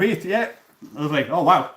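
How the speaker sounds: normal talking voice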